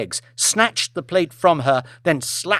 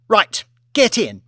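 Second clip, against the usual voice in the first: cold order; 8 semitones higher, 6 dB louder than usual. Acted. Lady ordering waitress into bin.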